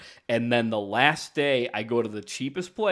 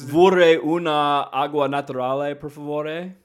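loud, higher-pitched amateur Italian, rising at the end; American tourist's Italian, pitched up; attempted Italian; 4 semitones higher, 7 dB louder than usual. Shown as a demonstration. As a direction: show off your clumsy Italian, lifting into a question. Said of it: "you should say something to us".

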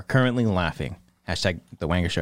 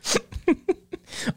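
wheezing laugh. Real, not acted. Breathy laugh.

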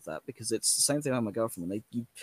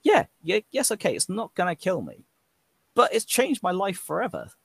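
a high, sing-song brush-off, mimicking the people who shrug it off; high and mocking, like an empty reassurance; dismissive mock voice; 8 semitones higher, 3 dB louder than usual. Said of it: "I hate that with a passion".

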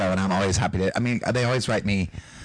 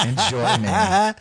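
smug actor; 9 semitones higher, 4 dB louder, much slower than usual. Retold or imitated. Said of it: "he's so like".

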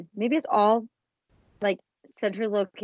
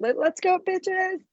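much higher, punchy party shout; hype cheer; 9 semitones higher, 4 dB louder, much slower than usual. Shown as a demonstration. Host showing fun swearing, hyped up cheer. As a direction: cheer like you're rallying friends.

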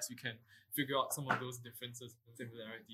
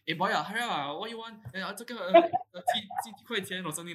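imitating a Singaporean hawker, higher and louder; Singaporean accent; 7 semitones higher, 9 dB louder than usual. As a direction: hawker barking orders in Singlish. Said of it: "they're like".

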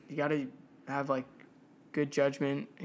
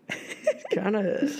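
laughing; 6 semitones higher, 3 dB louder, slower than usual. Real, not acted. Laughs while struggling to say.

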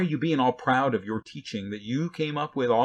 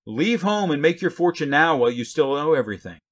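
higher, louder mock encouragement; sarcastic parent; 4 semitones higher, 5 dB louder than usual. Parent telling a know-it-all teenager to leave home, sarcastically. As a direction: urge it on with biting false enthusiasm. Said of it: "Or how about teenagers?".

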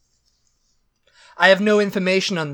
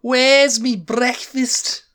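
exaggerated Cockney voice; hammed-up London accent; put-on Cockney accent; 7 semitones higher, 4 dB louder, much slower than usual. Echoes in put-on voice.

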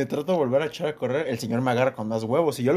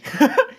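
high burst of real laughter; 11 semitones higher, 6 dB louder than usual. Real, not acted. Telling a friend about the fight, bursting into laughter. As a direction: laugh out loud at himself.

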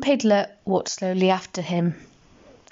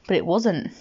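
ordinary delivery

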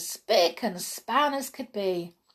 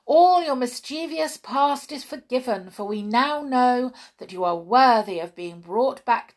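higher, louder royal speech of praise; the king and queen hailing the heroes aloud; cried welcome; 5 semitones higher, 5 dB louder than usual. A narrator's reading. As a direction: proclaim it warmly to the brownies. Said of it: "they cried".

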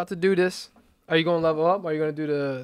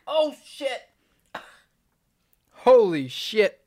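shocked; 9 semitones higher, 5 dB louder, much slower than usual. Real, not acted. Ghost pepper hitting hard.

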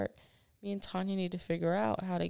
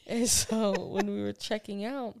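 ordinary talk, nothing marked

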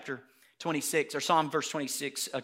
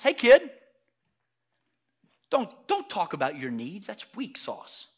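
lightly acted grown-up talking down to a kid; a hint of a dismissive older guy; dismissive adult; 5 dB louder than usual. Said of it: "the conversation between the adult and the little boy".

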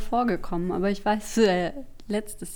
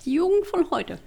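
put-on high, huffy old-timer grumble; grumpy old man; 8 semitones higher than usual. Mock old-timer complaint.

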